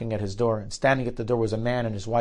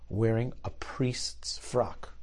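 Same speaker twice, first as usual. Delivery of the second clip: slow, breathless whisper of the catch; sly whisper; 7 dB quieter, much slower than usual. Retold or imitated. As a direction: let the cruel twist slip out in a slow whisper. Young man reveals mocking condition.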